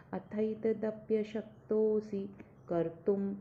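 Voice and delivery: "कर्तुं" ending with an m sound; slow recitation. Shown as a demonstration. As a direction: recite slowly, "कर्तुं" closing on m. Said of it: "अनुनासिक है ... म आएगा".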